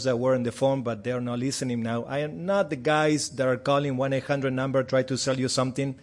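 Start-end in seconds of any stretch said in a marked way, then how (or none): none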